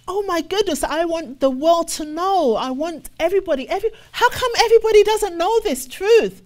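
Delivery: high, loud, eager outburst; excited believer. Retold or imitated. Preacher voicing a believer desperate to tell the world, high and excited. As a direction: burst out wanting everyone to know.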